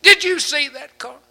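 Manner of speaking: high, loud panicked warning; alarmed wife. Retold or imitated. Wife warning her husband about a car, high and loud. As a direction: shriek the warning from the passenger seat. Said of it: "My wife does me this way".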